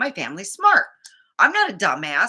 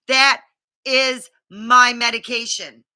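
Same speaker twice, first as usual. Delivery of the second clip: firm insistence; 3 semitones higher, 8 dB louder than usual. Retold or imitated. Pointing, insisting to assistant.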